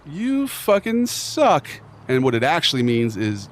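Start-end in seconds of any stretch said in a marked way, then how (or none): 0.0-1.7 mock bro insult. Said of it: "It's like".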